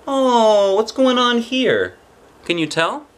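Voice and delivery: high, drawn-out, loud ooh of curiosity; playful wonder. Pretend. Reader showing children a picture, playful wonder. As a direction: draw it out high in exaggerated wonder.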